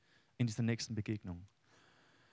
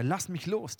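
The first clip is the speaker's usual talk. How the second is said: higher, urgent plea to be released; the man begging Jakob, pitched up; pleading stranger; 6 semitones higher, 3 dB louder, slower than usual. Acted. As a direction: let go of me, said higher and pressing. Stranger voiced in Bible reading.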